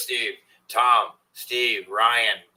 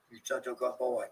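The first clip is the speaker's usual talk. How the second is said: talking to a dog; 10 dB quieter than usual. Real, not acted. Praising his dog.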